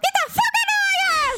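squeaky high mock yell; hammed-up high-pitched outburst; mock outraged shout. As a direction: shriek it in fake outrage. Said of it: "I'm like".

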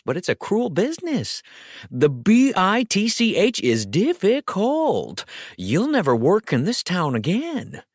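a fast-talking showbiz agent, pleading, then warning. Acted. Agent Al pleading with his star Kathy. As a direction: coax her, then lay down the threat.

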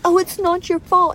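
high, sugary mock sympathy; mocking other coaches. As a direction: mimic soothing coaches in a high voice. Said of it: "They're like".

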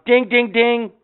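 high, quick repeated bell dings; imitated bell. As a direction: mimic an insistent little bell. Imitates the dog ringing bell.

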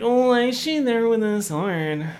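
loud, high put-on whine; hammed-up high, loud mock wondering; mock reaction voice. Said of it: "And I was like".

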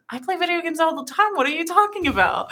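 high-pitched mock protest; mock-indignant comeback; incredulous retort. Retold indignant reply to a viewer.

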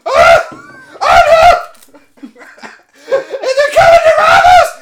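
very high, screaming mock terror; hammed-up panic. Teller goes running to the manager, hammed-up panicked screaming. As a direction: shriek it like a comically frantic bank teller. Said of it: "she goes running to the manager".

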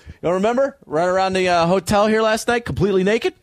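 high mock clerk asking; hotel clerk voice. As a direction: squeak it out like a startled desk clerk. Hotel guy voiced in story.

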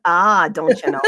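mock Minnesota accent, lightly done; a hint of a Fargo Minnesota twang. Host teasing a Fargo viewer, mild Minnesota accent. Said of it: "I know how to talk like that".

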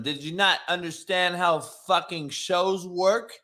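ordinary talk, nothing marked

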